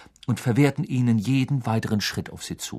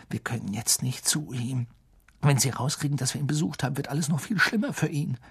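urgent hush; 5 dB quieter, faster than usual. Acted. Holding Harry back under cloak.

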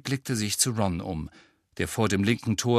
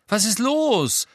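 high, loud, drawn-out question; impatient raised-voice demand; 11 semitones higher, 5 dB louder, slower than usual. A narrator's reading. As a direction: call it out impatiently. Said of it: "sagte er ungeduldig".

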